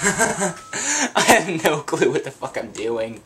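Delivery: laughing through the words, slowed; giggling helplessly at being lost. Real, not acted. Player laughing at his own confusion, laughing.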